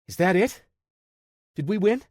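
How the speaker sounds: put-on high, eager kid's voice; a worried young tech asking fast; nervous kid checking on the tech; young Parker. A narrator's reading.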